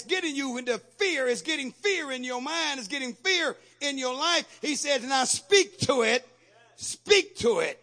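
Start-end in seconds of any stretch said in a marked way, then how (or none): 5.0-7.8 commanding. Acted. Preacher voicing Jesus' command.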